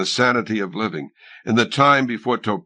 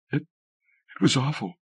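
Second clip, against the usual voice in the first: softer, slow halting voice; a shaken whisper of horror; 5 semitones higher, 4 dB quieter than usual. A narrator's reading. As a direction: stammer it out in shock. Alita shaken after killing.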